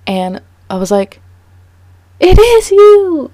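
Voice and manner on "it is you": high, loud burst of joy; relieved shout. Retold or imitated. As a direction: cry out on finally seeing him. Finding the lost dog.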